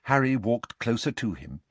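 usual speaking voice

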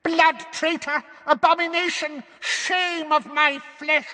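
slow, very high screamed curses; howling old woman. A narrator's reading. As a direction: screech denunciations, stretching the words. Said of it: "she howled".

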